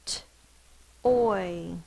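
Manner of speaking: T, then a loud oy; sounding out toy. Shown as a demonstration. Teacher sounds out toy for kids, sound by sound. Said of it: "Sound it out".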